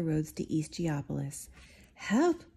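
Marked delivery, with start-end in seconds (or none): 1.9-2.4 a high yelp of Help,; calling out. Acted. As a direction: call for help across the snow. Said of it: "called out the superintendent".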